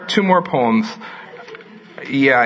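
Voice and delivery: ordinary talk, nothing marked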